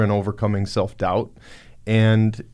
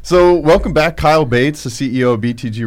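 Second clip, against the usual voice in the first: louder, higher radio host welcome; 5 semitones higher, 8 dB louder than usual. Acted. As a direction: boom it out like a morning show host. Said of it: "sounding more and more like a radio D J".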